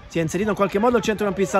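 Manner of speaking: ordinary talk, nothing marked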